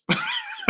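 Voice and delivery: a sudden high squeak of a laugh. Real, not acted. Laughing to his co-host at his own money worries, high laugh. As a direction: let a high laugh escape.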